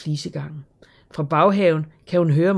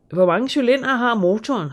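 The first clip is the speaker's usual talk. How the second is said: higher, keen question about the engine; interested; 7 semitones higher, 5 dB louder than usual. A narrator's reading. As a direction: lean in, eager to know. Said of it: "spørger Knud interesseret".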